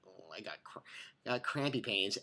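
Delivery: very low, barely audible moan; hushed, low-pitched mock suffering; mock cramp complaint. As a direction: mumble it softly as if cramping. Acts out having cramps.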